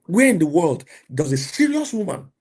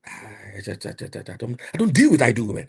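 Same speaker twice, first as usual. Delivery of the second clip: usual speaking voice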